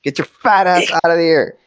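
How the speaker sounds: high, strained urging; straining rescuer. Acted. Character still tugging stuck friend out, straining. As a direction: push it out as if mid-heave. Acting out hauling a friend free.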